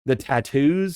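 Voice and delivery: normal talking voice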